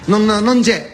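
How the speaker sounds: ordinary talk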